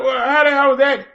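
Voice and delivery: ordinary talk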